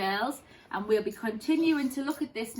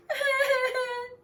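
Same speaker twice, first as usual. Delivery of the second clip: cub sob; 4 dB louder than usual. Acted. Baby Bear crying.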